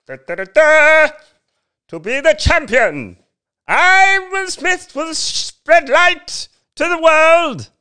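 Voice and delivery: loud falsetto superhero fanfare; Will Smith. Acted. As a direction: proclaim it like a pompous champion, very high and drawn out. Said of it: "as opposed to Will Smith going".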